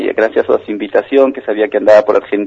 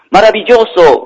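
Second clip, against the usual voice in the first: high, loud jubilant call; ringing forceful cry; father's shout; 10 semitones higher, 3 dB louder than usual. Acted. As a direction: shout it wide into the cave.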